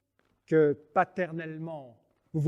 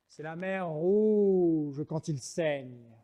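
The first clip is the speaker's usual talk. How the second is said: dramatic; much slower than usual. Acted.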